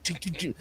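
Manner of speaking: soft, sharp mouth clicks; imitated creature clicking. Dreamer showing the creature coming up the driveway, imitated clicking. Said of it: "this thing shows like".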